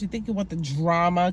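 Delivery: usual speaking voice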